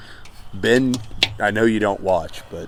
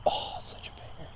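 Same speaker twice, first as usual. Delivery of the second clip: whispering; 15 dB quieter than usual. Real, not acted. Aside in a whisper.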